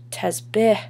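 ordinary delivery

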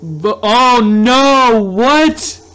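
drawn-out loud wail climbing high; shocked dismay. Real, not acted. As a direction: stretch it out, rising into disbelief. Dismayed by game result.

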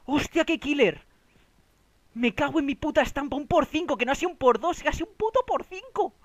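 breathless excited recap; still hyped. Real, not acted. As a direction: gush about the win, still buzzing. Recounting the win, still hyped.

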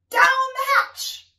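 high, drawn-out rowdy cheer; shouting. Acted. Reader leads listeners in the crew's echo, shouting. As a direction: stretch out a high pirate shout. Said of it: "we all shouted".

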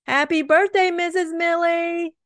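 high, loud birthday cheer; surprise-party shout; happy shout. Acted. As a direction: shout it like kids jumping out. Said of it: "we shouted".

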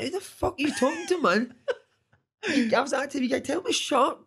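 very high, laughing disbelief; mock incredulous outburst. Dad retelling his shock at son's cheek, mock incredulity. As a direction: squeal it in comic disbelief, half laughing.

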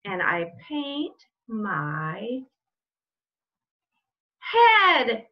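lively read-aloud with slow build-ups and loud punch words; reading to children. Acted. Teacher reading a picture book to her class, lively read-aloud. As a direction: stretch the setup, then belt out the body part.

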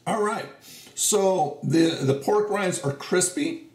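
speaking between chews; chewing while talking. Real, not acted. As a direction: mouth partly full. Seen 1: Chews bite from -5; lips close, purse between words during labelled words.

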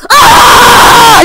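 very high, full-force scream; retaliatory scream. Shown as a demonstration. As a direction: scream right back to outdo them. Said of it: "I can do that too".

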